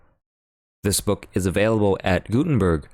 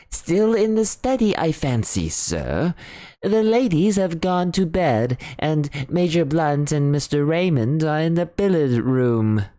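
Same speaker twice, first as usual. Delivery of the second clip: higher, deferential butler voice; butler Parker; 10 semitones higher than usual. Acted. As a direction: report politely to the doctor. Parker answers.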